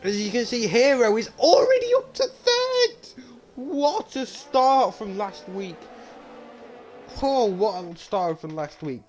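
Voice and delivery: soaring high play-by-play, slowing on the praise; high, drawn-out excited call easing down; excited race commentary. Pretend. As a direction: marvel at the comeback like a commentator. Live call of comeback.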